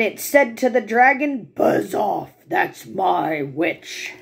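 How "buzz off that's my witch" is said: low, slow growling beast; monster growl. Acted. Swamp beast warning off the dragon, low growl. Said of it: "a yowl and a growl and a croak and a shriek".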